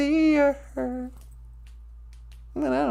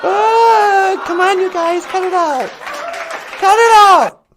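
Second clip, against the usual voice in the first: hammed-up wounded; 4 semitones higher, 7 dB louder than usual. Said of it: "Don't laugh at me".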